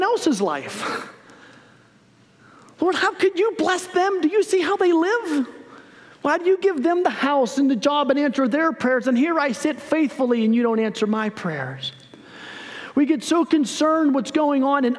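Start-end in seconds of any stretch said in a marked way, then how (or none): 2.8-11.9 higher-pitched whine to God, easing toward the end; acted aggrieved pleading; mock envious complaint. Believer complains to God about others' blessings, mock aggrieved.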